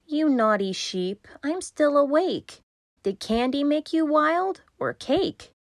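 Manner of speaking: a hint of a scolding child. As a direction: scold the sheep, mock stern.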